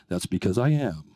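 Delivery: very deep, close announcer voice; intimate one-to-one. Acted. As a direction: drop very low, as if for one listener. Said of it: "it sounds like you're talking to me".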